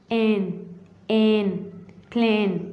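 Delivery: एन, एन, प्लेन, each vowel held as "ay"; long A sound. Shown as a demonstration. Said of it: "ऐसे करके".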